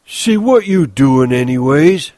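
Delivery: high, loud, drawn-out demand; crying out. A narrator's reading. Lennie challenges Crooks's supposing, crying out. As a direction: cry it out in upset protest. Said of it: "he cried".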